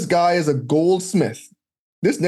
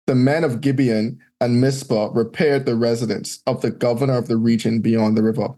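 scripture reading; 7 semitones lower than usual. Acted. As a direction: read out the old names like a lector. Reads scripture aloud.

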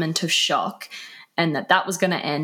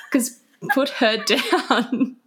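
laughing; 6 semitones higher, slower than usual. Real, not acted. Still cracking up at herself.

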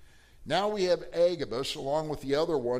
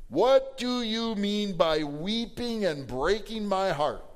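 high, slow, louder rebuke of his friends; Paul's reply; 5 semitones higher, 4 dB louder, slower than usual. Acted. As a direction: ask why they weep, a touch hurt. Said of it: "what does he say?".

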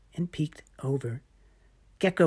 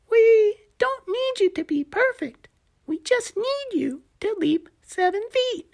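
high little gecko explaining; patient gecko, much higher; 6 dB louder than usual. Acted. Gecko voiced by narrator.

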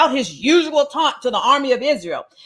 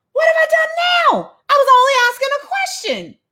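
very high, whiny protest; hammed-up, high-pitched sibling complaint; indignant little brother. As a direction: plead innocence in a high kid-brother voice.

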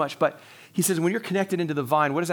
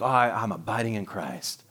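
lower, softer, hushed and syrupy; imitating a gushy churchgoer, much lower and softer; mock dreamy piety; 8 semitones lower, 5 dB quieter than usual. Shown as a demonstration. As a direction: sigh it out like a swooning feeling. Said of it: "We say it like that".

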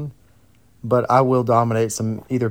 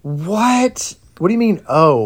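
shocked disbelief; 8 semitones higher, 5 dB louder, slower than usual. Real, not acted. Shocked she skips Reese's.

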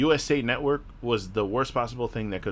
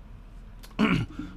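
throat clearing; 9 semitones higher, 3 dB quieter than usual. Real, not acted. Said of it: "My throat hurts".

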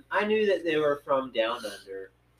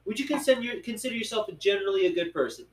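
quick interviewer; 7 semitones higher, faster than usual. Retold or imitated. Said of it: "He's like".

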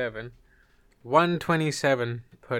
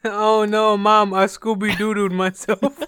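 loud, high mock-distressed kid; mock child confessing; 7 semitones higher, 9 dB louder, slower than usual. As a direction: wail to Mom in playful panic. Acts a child confessing to Mom.

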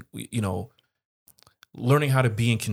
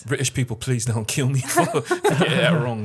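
laughing it out, louder and higher; laughing apology; 5 semitones higher, 6 dB louder than usual. Real, not acted. Begging British listeners' forgiveness, laughing loudly. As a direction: giggle through the apology.

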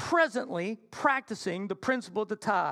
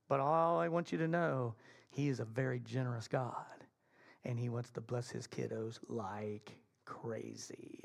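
very soft, low, slowing down; hushed, heartfelt close of a sermon; tender assurance; 10 semitones lower, 8 dB quieter than usual. Real, not acted. Hushed closing assurance to congregation.

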